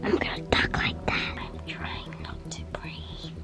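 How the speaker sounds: barely audible hiding whisper; whispering while hiding. Real, not acted. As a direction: hold your breath and whisper.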